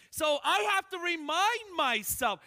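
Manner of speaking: normal talking voice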